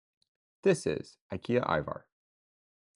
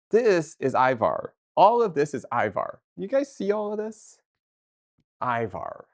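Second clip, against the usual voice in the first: excited showing off; 5 semitones higher, 3 dB louder than usual. Real, not acted. Streamer hyping Ivar shelves to chat, excited.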